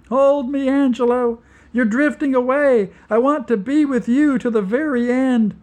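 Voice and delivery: high, loud desperate cry; woman crying out to Angelo as they drift apart; terrified plea. Acted. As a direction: beg him to hold on, loud and high. Rabatine pleading in terror.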